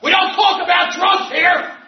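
a loud, high scolding yell across the room; mock shout. A member yelling at a newcomer in the meeting, loud scolding shout. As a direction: bark the rule at the newcomer like a self-righteous member. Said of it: "from across the room shout out".

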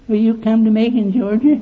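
high, imitated caller asking a favour; phone caller voice. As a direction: play the caller begging him to come.

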